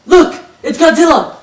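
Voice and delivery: high, loud alarm; excited shout. Acted. Kid pointing out Godzilla, high excited shout. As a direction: yell it like spotting a monster. Calling out monster.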